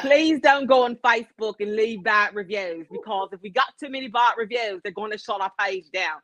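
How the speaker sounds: pleading mock British company owner; British accent. Owner begging customers over bad reviews, mock British accent. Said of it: "Why are we allowing this fool to do this accent?".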